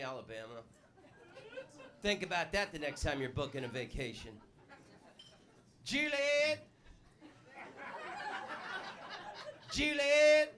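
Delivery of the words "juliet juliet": high Southern yell for Juliet; calling Juliet. Retold or imitated. Comic playing Alabama Romeo calling Juliet, high yell. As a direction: holler up at the balcony.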